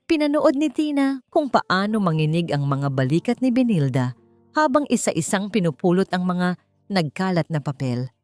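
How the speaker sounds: ordinary delivery, nothing marked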